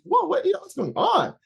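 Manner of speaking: high-pitched mock bewilderment; retold astonishment. Man startled by his own crying, high baffled voice. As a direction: pitch it way up, acting thrown by yourself.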